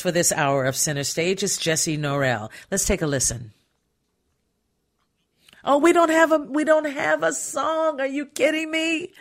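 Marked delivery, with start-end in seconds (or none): 5.6-9.1 high-pitched startled disbelief, slowing on "we don't have a song"; real on-air surprise, voice jumps up high; caught off guard. Real, not acted. Host finds no song cued.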